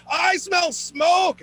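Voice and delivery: high, slow, loud alarmed voice. Retold or imitated. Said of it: "she's like".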